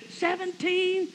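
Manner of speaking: high, drawn-out scoffing question; incredulous. Retold or imitated. Usher objects to the young preacher's age, incredulous. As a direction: stretch it up high in disbelief.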